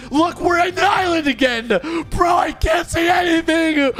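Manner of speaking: mock panic, shouting high; pretend panic. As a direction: yell like you're lost and scared in the dark. Lost at sea, panicking.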